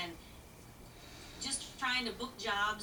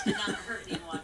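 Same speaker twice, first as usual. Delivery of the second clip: laughing. Real, not acted. Laughs at her own joke.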